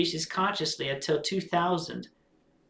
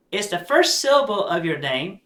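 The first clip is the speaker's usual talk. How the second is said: usual speaking voice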